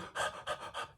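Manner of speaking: quick panicked gasp; fast shallow breath in; rapid gasping. Shown as a demonstration. Showing how fast breathing feels, sharp gasp. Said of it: "you can do this right now".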